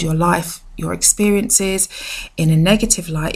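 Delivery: usual speaking voice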